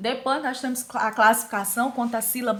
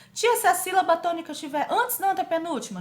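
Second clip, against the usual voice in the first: higher-pitched pupil questioning the teacher; pupil's question; 7 semitones higher, 4 dB louder, faster than usual. Acted. Pupil asks teacher about the tonic syllable, lightly voiced pupil's question.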